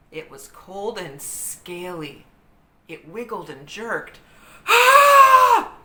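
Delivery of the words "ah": sudden loud high scream of fright. A narrator's reading. Jack grabs a snake and screams. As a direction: shriek as the vine wriggles. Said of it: "Jack screamed".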